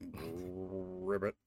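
low, soft frog croak. Acted. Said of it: "slowly sleeping and just going".

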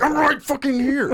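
high-pitched retold exasperation; mock-irritated outburst; anxious snapping. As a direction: re-enact losing patience on the phone. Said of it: "I get anxious".

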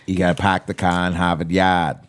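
put-on light Boston accent, dropped r's. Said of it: "I don't even know how to do a Boston accent".

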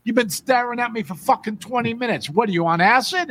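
high-pitched, irate New Yorker; high and indignant with a thick New York accent. Retold or imitated. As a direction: rant like an exasperated subway New Yorker. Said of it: "goes all New York".

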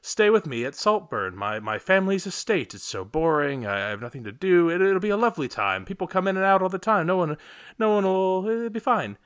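breezy and carefree, pitch climbing high; Felix inviting. Retold or imitated.

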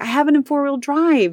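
high-pitched defensive reply; retold protest. Wife defending herself to annoyed husband, high protest. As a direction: argue back with the pitch jumping up.

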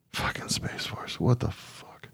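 whispered disgusted aside; whispered curse. Real, not acted. Fed-up podcaster whispering disgust about Space Force. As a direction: hiss it under the breath in disgust.

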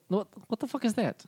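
high, fast, sharp what the fuck; Anna Meek snapping. Acted. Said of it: "and she, she goes".

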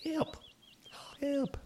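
tiny high plea for help; a tiny little voice calling help. Acted. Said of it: "you hear a tiny little voice that's like".

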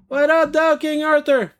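high-pitched, hollered hello; performed greeting. Acted. Host reading a listener's letter, high called-out greeting.

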